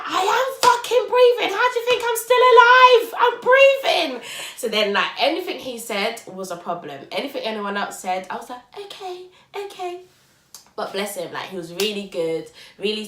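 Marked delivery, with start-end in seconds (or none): 0.0-4.2 very high, loud enraged yell; furious shout. Retold or imitated. Snapping at partner in labour. 8.8-10.0 high, dismissive okay, okay. Retold or imitated. Placating everyone in labour.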